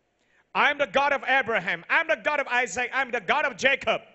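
loud, high declaration of each name; loud proclaiming. Shown as a demonstration. Preacher voicing God to the congregation, loud and ringing. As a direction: ring out every generation. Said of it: "He would complete the whole sentence like that".

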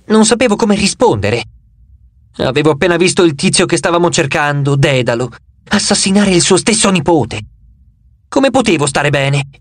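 plain reading voice

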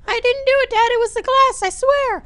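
loud, very high falsetto pleading; squeaky kid voice. Acted. Timmy denying blame to dad, pleading squeaky kid voice. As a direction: protest in a frantic squeaky falsetto.

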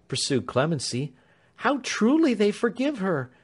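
high, tender begging voice; pleading Clemency. A narrator's reading. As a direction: coax the truth from him gently.

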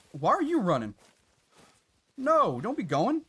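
ordinary talk

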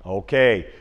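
usual speaking voice

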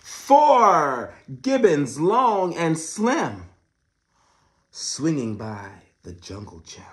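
loud, slow storybook read; big reading voice. Shown as a demonstration. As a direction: boom out the rhyme slowly. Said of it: "Oh my goodness".

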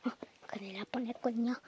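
fast unvoiced whisper. Real, not acted. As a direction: whisper it quickly in relief. Near miss aside.

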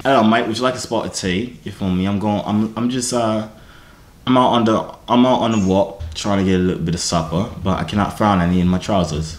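low-pitched, put-on British accent. Shown as a demonstration. Man demonstrating his accents for a stranger to judge, British accent. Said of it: "let me get the accents again".

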